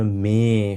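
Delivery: মে held long on the E; umlaut as E. Shown as a demonstration. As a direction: stretch the vowel of মে to show the sound. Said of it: "এটাকে আমরা এ উচ্চারণ করি". (মে-র এ ধ্বনি টেনে বলা)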